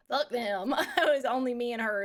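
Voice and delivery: ordinary delivery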